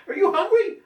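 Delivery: high, loud questioning to the cat; pet talk. Real, not acted. Owner asking his biting cat if it's hungry, high. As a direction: ask the cat sweetly but loud.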